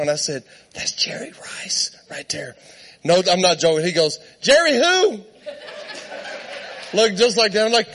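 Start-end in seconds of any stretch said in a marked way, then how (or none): 0.7-2.6 hushed retold whisper, very soft; starstruck whisper. Leans over to tell seatmate. 4.4-5.2 high, loud mock bewilderment; clueless question. Clueless friend asks loudly.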